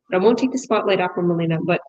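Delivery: normal talking voice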